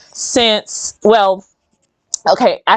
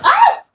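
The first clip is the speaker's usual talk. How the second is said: sudden high yelp of surprise; a sharp, high startled cry. Real, not acted. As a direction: yelp as the water spills. Water dropped, sudden startle.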